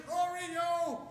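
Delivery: long, plaintive search call; mock crying call. Searcher calling the missing dog, mock crying. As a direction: imitate a tearful call for a lost pet.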